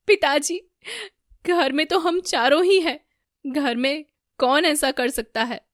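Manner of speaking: high, loud bewildered question; loud alarm. A narrator's reading. Son asks father who could do this, loud alarm.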